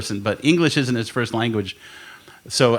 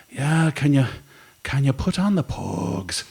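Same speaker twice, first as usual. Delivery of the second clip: put-on sedated Irish accent; 4 dB quieter than usual. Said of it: "talking in some Irish accent".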